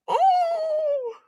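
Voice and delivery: high startled gasp of an oh; a breathless high cry; high surprised oh. Real, not acted. Streamer overwhelmed by a big donation, high oh.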